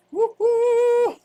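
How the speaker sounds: loud, high woo-hoo; whooping cheer. Real, not acted. Cheering on the Fish Fam, loud high whoop.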